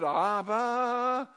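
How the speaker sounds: very slow, tender "Abba, I belong to you"; slow, heartfelt prayer voice. Retold or imitated. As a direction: say it like a child to a father. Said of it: "how many of you said".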